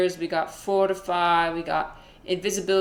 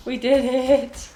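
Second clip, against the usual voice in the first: high, loud, slow victory cry; excited shout; 7 semitones higher, 7 dB louder, slower than usual. Real, not acted. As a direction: shout the win to chat. Celebrating beating the level.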